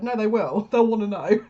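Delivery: laughing through the words; quoting Spirit while laughing; laughing quote. Retold or imitated. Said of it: "Spirit just said".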